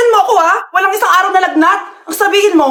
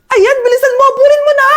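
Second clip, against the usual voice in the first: excited cheering; 10 semitones higher, faster than usual. Acted. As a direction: yell at the hero to hurry. Mother cheering at TV show.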